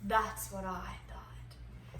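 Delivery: slow, low secretive whisper; hushed whisper. Acted. Secretive search for hidden door.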